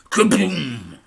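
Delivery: high, loud kaboom; a narrator calling out a car exploding; sound effect. A narrator's reading. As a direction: blast out the explosion. Sound effect read in story.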